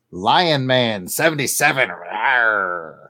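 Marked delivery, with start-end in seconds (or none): 1.9-3.1 low growled rawr; mock roar. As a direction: growl like a lion. Lion roar after Lion Man.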